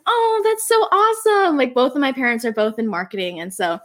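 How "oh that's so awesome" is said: 1 cheery mom. Retold or imitated. Mom reacts to her likes.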